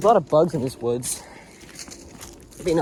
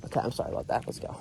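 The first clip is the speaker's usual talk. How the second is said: hushed; 6 semitones lower, 10 dB quieter, faster than usual. Real, not acted. Unvoiced apology after a joke.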